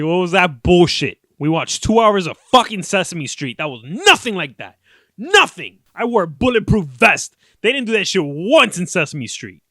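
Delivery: loud, indignant mock rant; imitated outrage. Daughter complaining after the dentist visit, mock outrage. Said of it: "She's so pissed".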